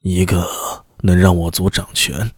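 low, hushed scheming voice; secretive envoy. Acted. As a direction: confide the plan low and quiet. Envoy reveals ambition.

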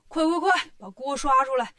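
loud, urgent barked orders; shouting demon king. Acted. Demon king orders his minions to cook the monk, loud hurried shouting. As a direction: yell for the pot in a greedy hurry. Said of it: "进洞就喊".

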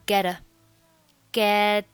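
"get a" slowly joined as "geh-da"; voiced linking. Shown as a demonstration. Teacher showing voicing, "get" plus "a" as "geh-da". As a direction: soften the t of "get" into a d before "a". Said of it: "要浊化一下".

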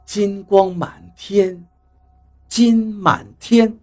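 very slow, softened musing to oneself. A narrator's reading. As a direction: draw each word out while trying to remember. Puzzling over the remembered phrase. (若有所思地慢慢念)

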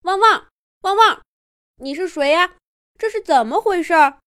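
high playful puppy voice, yapping the woofs; a little dog barking questions. A narrator's reading. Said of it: "汪汪地对着小汽车叫起来". (小狗汪汪叫的声音)

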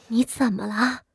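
soft, startled undertone; alarmed hushed question. A narrator's reading. Worried mother dares not speak openly before son-in-law, hushed and alarmed. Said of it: "只惊疑地低声道".

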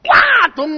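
high, loud explosive thud; shouted imitation of a heavy fall; crash noise. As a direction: burst out the impact sound.

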